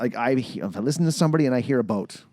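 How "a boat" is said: Canadian "a boat" vowel; Canadian accent. Shown as a demonstration. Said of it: "I notice the Canadian accent".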